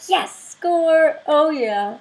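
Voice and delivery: high, loud little victory cheer; excited cheer. Retold or imitated. Thrilled customer cheering at the yard sale cook. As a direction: celebrate the free extra food. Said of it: "I did a little excitement moment".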